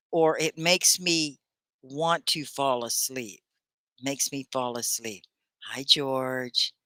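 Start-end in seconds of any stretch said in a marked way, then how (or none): 0.4-5.2 slow, spaced-out teacher's demonstration; slow model sentence. Shown as a demonstration. Teacher giving Tony the correct sentence, slow and spaced out. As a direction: model it phrase by phrase, unhurried. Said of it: "You say it like this".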